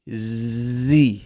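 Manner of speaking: Z with a long buzzing start; distinct Z sound. Shown as a demonstration. Teacher reaching the last letter, long buzzed Z. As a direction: hold the zzz before the vowel in Z. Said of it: "dalawang letters, so which is, or which are C and Z".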